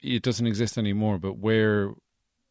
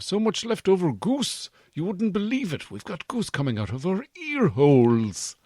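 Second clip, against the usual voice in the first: Santa voice; 10 semitones higher, 4 dB louder than usual. Acted. Santa voice, high.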